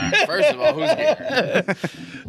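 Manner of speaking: retelling his own confusion, hamming it up high and slow; mock disbelief. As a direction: stretch out a baffled, high "who's Gary". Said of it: "I was like".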